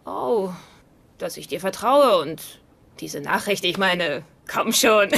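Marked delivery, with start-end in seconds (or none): none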